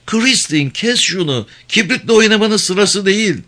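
put-on high child voice giving orders; scolding little girl. A narrator's reading. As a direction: scold like a small child playing the grown-up, high-pitched. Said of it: "Küçük kız bir an bu taktiğin". (tiz sesle azarlayan küçük kız)

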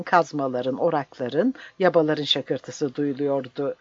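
usual speaking voice

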